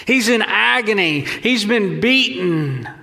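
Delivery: normal talking voice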